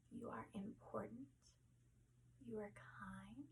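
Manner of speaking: calm them down gently; guided meditation. Acted. Leads relaxation.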